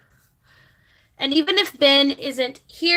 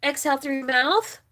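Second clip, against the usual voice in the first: guided breathing. Acted. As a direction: guide viewers through each breath, unhurried. Leads a breathing exercise.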